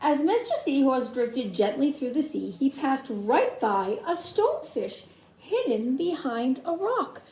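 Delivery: ordinary delivery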